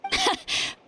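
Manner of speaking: high, quiet little chuckle; short laugh. Acted. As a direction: let out a brief amused laugh. Amused before teasing about barbecue.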